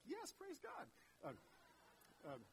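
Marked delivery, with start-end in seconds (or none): 0.0-0.9 high, relieved mock praise; the wife glad he's finally quiet; delighted wife. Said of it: "His wife's like".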